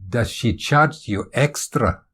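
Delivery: slow, probing Scottish question; deliberate Scottish curiosity; Scottish accent. Acted. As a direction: ask it slowly in a Scottish accent. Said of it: "Is that a Scottish accent".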